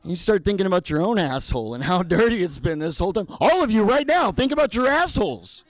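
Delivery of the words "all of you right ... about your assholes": loud, high mock order to the crowd; shouted command. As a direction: bellow it at the whole room like an urgent order. Comic commands the whole audience.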